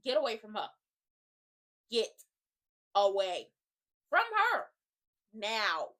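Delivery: loud, word-by-word command with pauses; emphatic order. Acted. Telling Andre to leave that woman, emphatic order. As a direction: punch GET, AWAY, FROM HER and NOW separately.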